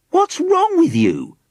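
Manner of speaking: slow, very high-pitched challenge; exasperated cry. A narrator's reading. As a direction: cry out in disbelief. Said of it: "he cried".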